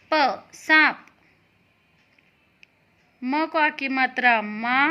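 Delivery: usual speaking voice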